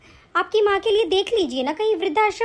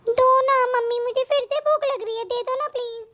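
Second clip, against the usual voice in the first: put-on pleading little girl; 5 semitones higher than usual. Child pleads.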